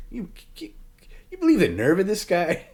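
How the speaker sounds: high, mock-disgusted disbelief; head-shaking, exaggerated outrage; disgusted Perry impression. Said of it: "He's like, shaking his head in disgust".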